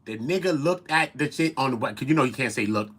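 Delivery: normal talking voice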